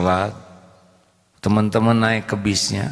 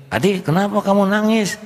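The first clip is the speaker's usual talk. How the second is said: much higher, laughing mother's voice; teasing mother; 8 semitones higher than usual. Retold or imitated. Mother teases the crying boy about the Alphard, high and laughing.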